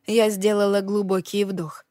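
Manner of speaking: usual speaking voice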